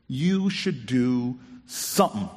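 drawn-out, leaning-in secret tone; string him along, one slow word at a time; slow teasing tease. Retold or imitated. Neighbour leaning in to tease the frustrated mayor, slow teasing.

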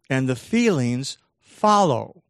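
normal talking voice

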